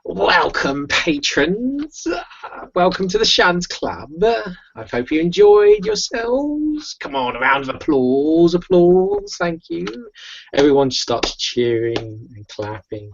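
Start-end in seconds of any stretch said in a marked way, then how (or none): 0.0-9.9 high, drawn-out showman's welcome; showman screaming out. Acted. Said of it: "he just sort of screams out".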